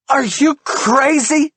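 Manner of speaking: high, loud, slow furious scream. A narrator's reading. Wife screams at husband, furious. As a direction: scream it at him. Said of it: "she screams".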